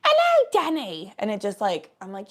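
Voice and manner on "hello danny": high, drawn-out character hello; louder put-on greeting voice; impression of a woman's greeting. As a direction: call it out slow and high, in character. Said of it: "do it as Edmund".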